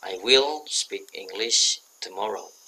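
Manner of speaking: slow and loud, every word clear; slow model sentence. Shown as a demonstration. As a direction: read it out slowly for students to copy. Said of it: "Nah, ini". (dibaca pelan dan keras sebagai contoh)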